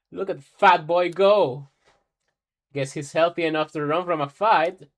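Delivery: gloating mockery. Old woman mocks fleeing boy.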